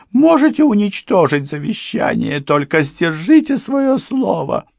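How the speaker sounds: pleading housekeeper. A narrator's reading. Housekeeper pleading with the lawyer over money, high and tearful. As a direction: beg them to keep the promise. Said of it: "housekeeper pleads".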